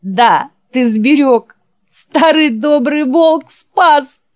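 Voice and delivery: high, loud, slow crying words; weeping out his thanks; sobbing aloud. Acted. Jack sobbing over the dying Wolf, loud and high. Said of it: "зарыдал во весь голос".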